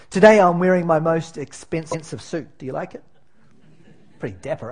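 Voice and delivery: ordinary talk, nothing marked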